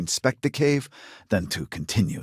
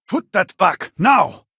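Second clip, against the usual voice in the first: angry shout; 11 semitones higher, 8 dB louder, slower than usual. Acted. Catches someone taking something.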